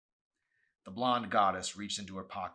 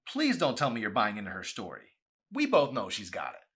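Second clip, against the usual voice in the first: loud exasperated scolding; angry; 4 semitones higher, 11 dB louder than usual. A narrator's reading. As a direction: tell him off. Chelsea scolds Arson.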